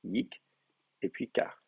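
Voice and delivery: "Yik", pause, then "kar", both softly closed; two closed syllables. Shown as a demonstration. Teacher counting two syllables, soft separated "Yik" and "kar". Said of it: "deux syllabes fermées".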